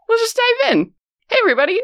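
bright, high launch into show; high excited host greeting. Real, not acted. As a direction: announce the start with energy. DM launching the stream.

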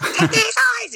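very high sung note. Shown as a demonstration. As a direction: reach right up to the top note. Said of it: "and I do the high notes".

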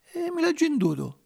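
high-pitched casual explanation; lightly acted high dismissal; offhand excuse. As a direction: brush the question off in a high voice. Geppetto explains the missing jacket.

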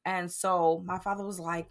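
usual speaking voice

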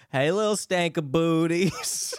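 slow put-on greeting voice; imitating Instagram guy. Friend imitating a guy's morning greeting, slow and put-on. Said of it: "he's always like".

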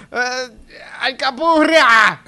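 high, slow drawn-out yell; mock drunken yell. Drunk guy near the door yelling food names, loud. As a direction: bellow it from the back of the room. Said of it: "cerca de la puerta gritando".

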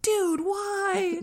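high-pitched, drawn-out incredulous plea; exasperated disbelief. Retold or imitated. Investigators to brother who hung up on caller, incredulous. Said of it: "investigators were like".

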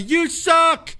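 high-pitched playful mock taunt; smack talk. Smack talking with your buddy, high mock jeer. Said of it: "smack talking with your buddy".

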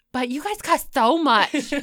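a hint of mom's reproach; scolding mom.

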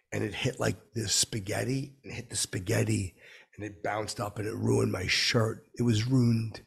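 usual speaking voice

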